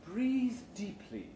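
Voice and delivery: slow, soothing instructor voice; calm the new hires down, slowly; soothing trainer. Acted. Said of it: "the new hire trainer's voice says soothingly".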